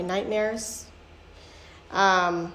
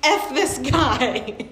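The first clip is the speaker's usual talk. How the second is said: higher and slow, a put-on voice venting at God; mock fed-up outburst; 6 semitones higher, 4 dB louder, much slower than usual. Retelling therapy, mocking God in a fed-up voice. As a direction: drag out a sarcastic gripe. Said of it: "I'd be like".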